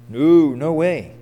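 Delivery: normal talking voice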